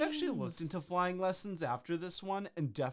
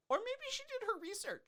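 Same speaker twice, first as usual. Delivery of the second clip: much higher mocking sing-song. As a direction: float it up high and silly.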